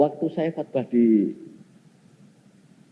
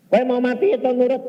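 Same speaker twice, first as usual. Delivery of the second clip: stern scolding; 12 semitones higher, 7 dB louder than usual. Retold or imitated. Pastor scolds the husband.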